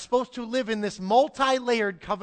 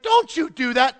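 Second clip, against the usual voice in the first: sharp, loud indignant rebuke; Jesus rebuking the disciples indignantly; 6 semitones higher, 9 dB louder than usual. Retold or imitated. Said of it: "He was indignant".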